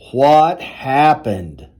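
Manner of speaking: ordinary delivery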